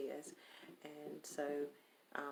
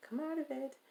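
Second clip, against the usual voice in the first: "Come out of it" jumps up high and firm; self-command, much higher; 11 semitones higher, 4 dB louder than usual. Real, not acted. Talking herself out of giggles, a high firm self-order. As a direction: order yourself to stop giggling, pitched up. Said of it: "So I'm telling myself".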